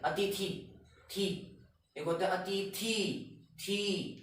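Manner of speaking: अतिथि with the final थी stretched slowly; drawn-out demo. Shown as a demonstration. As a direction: dwell on the last syllable थी. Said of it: "pronunciation बहुत जल्दी". (अतिथि, खींचकर बोला गया)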